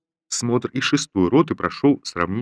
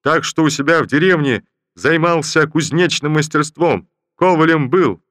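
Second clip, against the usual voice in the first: loud, higher eager soldier's report; 8 semitones higher, 6 dB louder than usual. Acted. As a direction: report proudly from the ranks. Soldier answers general loudly.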